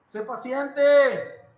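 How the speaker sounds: very slow and loud; drawn-out instruction. Shown as a demonstration. Preacher urging the congregation to patience, very slowly. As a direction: stretch every syllable of Sé paciente. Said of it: "todavía no pasa la prueba".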